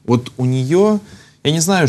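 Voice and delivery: usual speaking voice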